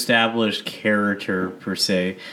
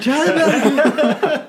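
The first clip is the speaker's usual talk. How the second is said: very high, slow, drawn-out; high silly voice; 4 dB louder, much slower than usual. Retold or imitated. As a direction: stretch it out in a squeaky voice. Mimicking character's name.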